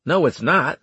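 blunt, loud disagreement; loud objection. A narrator's reading. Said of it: "I said loudly".

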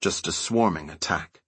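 plain reading voice

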